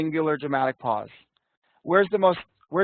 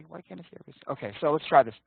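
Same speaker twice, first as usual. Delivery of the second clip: ordinary talk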